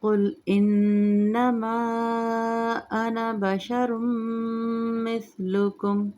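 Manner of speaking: slow melodic tajweed chant; Quran recitation. Shown as a demonstration. Recites verse.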